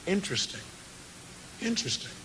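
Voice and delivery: hushed, thoughtful aside to oneself; quiet private wondering; musing. Retold or imitated. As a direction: ponder it softly, as if privately intrigued. Retold musing to himself.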